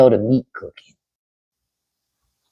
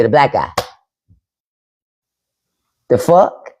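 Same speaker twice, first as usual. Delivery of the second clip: normal talking voice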